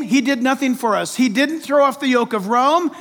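usual speaking voice

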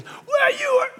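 very high, squealed comeback; mock protesting fan. Fan answering back to the cop, high mock protest. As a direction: blurt it in a silly high voice. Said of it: "And the guy just goes".

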